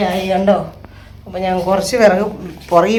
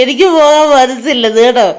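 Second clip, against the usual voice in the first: put-on higher, louder, whiny little kid pleading; child's plea; 5 semitones higher, 6 dB louder than usual. Grandmother imitating the grandchild begging to stay, in a higher, louder whiny voice. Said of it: "കൊച്ചു പറഞ്ഞ എന്നാന്നറിയോ".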